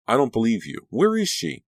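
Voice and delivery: sharp, high, demanding; angry demand. Acted. Danielle confronting Dee about Caroline, angry and high. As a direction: insist on an answer, voice rising.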